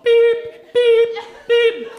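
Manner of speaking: high, loud mock reversing-truck beeps. Reversing like Madge from Benidorm, high and loud beeps. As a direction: beep like a lorry backing up. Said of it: "reversing like Madge from Benidorm".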